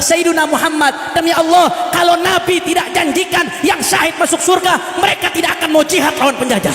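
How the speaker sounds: high-pitched rousing sermon, half shouted; rally the congregation with rising fervour; fiery preaching. Acted. Preaching at full force. (ceramah berapi-api, setengah berteriak)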